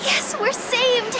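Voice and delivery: high-pitched, elated relief; relieved excitement. Acted. Lost hiker spots a cottage for senpai, excited relief. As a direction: cry it out as if rescue has appeared.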